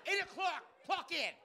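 high, loud barking manager; boss voice. Retold or imitated. Comic imitating the manager yelling at workers. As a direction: bark orders like a strict boss. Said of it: "Like Roger the manager".

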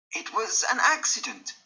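high, pleading voice; frantic pleading to his old friend; desperate. Acted. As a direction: beg to be believed. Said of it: "Dorian answered desperately".

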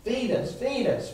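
acted plea to God; mock cry for food, rising on the repeats. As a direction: plead like hungry people crying to heaven. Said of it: "they cried out to God".

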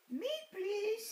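lightly voiced cat begging for a ride; Cat's voice. A narrator's reading. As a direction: chirp it like an eager kitten. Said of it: "says Cat".

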